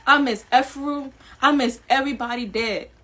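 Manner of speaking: ordinary talk, nothing marked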